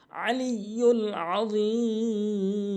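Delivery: আলিউল আযীম slow, the ayn pressed from mid-throat; correct ayn. Shown as a demonstration. Teacher shows the correct throat ayn, slow recitation. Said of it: "আইন এনে উচ্চারণ করতে হবে".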